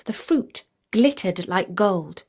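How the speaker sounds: plain reading voice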